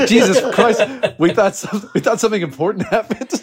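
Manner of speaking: high, loud, laughing exclamation; laughing disbelief. Retold or imitated. Friends laughing at a false alarm, loud and high. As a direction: laugh it out in exasperated relief.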